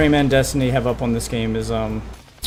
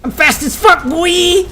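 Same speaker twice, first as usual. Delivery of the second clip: cocky excited shout; 4 dB louder, slower than usual. Acted. Character brags about speed.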